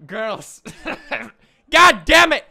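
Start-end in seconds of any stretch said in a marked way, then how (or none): 1.7-2.4 loud, high frustrated outburst; frustrated yell. Real, not acted. Kicked from the stage, cursing.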